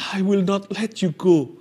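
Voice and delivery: slow, pleading, tearful; cling on with a tearful plea. Acted. Jacob begs the angel not to leave, tearfully. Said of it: "dia menangis".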